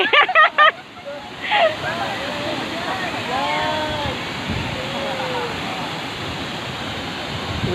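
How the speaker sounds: long high burst of real laughter. Real, not acted. Vlogger watching her friends go swim, laughing long and high.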